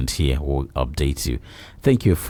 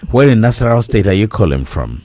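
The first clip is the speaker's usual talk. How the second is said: loud and fast, raised for a bad phone line; to someone hard of hearing; 7 semitones higher, 7 dB louder, faster than usual. Real, not acted. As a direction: project so the caller can hear.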